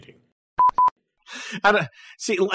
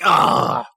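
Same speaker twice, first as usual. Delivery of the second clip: loud, higher imitated "Ah"; mock exasperated cry; 6 semitones higher, 7 dB louder than usual. Co-host imitating friend hearing "a trackball", mock exasperated cry. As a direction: mimic a friend's outburst, loud and raised. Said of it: "And you're like".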